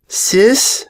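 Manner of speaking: high, small filly voice calling her sister; a little girl asking timidly; young filly voice. A narrator's reading. Filly calling her sister, high and small.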